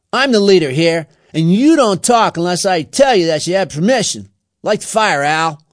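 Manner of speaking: loud, high bossy tirade; forceful gang-leader orders; domineering. A narrator's reading. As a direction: pull rank on them, loudly. Leader asserts his authority.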